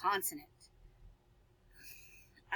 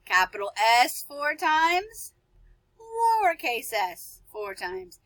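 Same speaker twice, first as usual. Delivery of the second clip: excited reveal; 5 semitones higher, 4 dB louder, slower than usual. Acted. Playful guess reveal after gasp.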